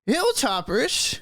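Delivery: put-on high, slow old gnome voice. Old gnome overhears a familiar name, high elderly voice. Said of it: "You hear a very old gnome go".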